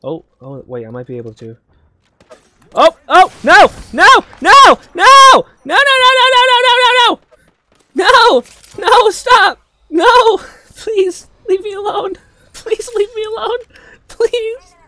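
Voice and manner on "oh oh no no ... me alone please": high, loud frantic shrieks; panicked pleading. Real, not acted. Gamer begging an enemy to leave them alone, shrieking panic. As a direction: scream no, then beg in a high squeal.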